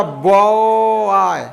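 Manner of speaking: बॉय stretched very slowly; drawn-out phonics demo. Shown as a demonstration. Teacher to pupils, slowly sounding out बॉय. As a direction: hold बॉय long for the class. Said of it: "यहाँ पर वही आ रहा".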